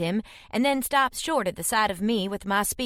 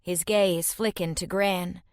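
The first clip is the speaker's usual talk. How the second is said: plain reading voice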